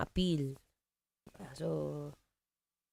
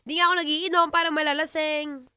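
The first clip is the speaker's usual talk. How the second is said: raised, laughing denial; loud protest; 7 semitones higher, 7 dB louder than usual. Real, not acted. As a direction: push back loud and high. Protesting being called drunk. (malakas na pagtanggi)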